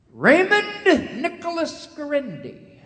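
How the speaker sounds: drawn-out stern call of the full name; a mother summoning her son, slow and loud; mother calling her son by his full name, stern and drawn out; mother's lecture voice. Retold or imitated. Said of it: "when my mother would begin".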